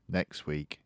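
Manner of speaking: soft, low-spirited echo; quiet dismay. Acted. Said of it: "I said".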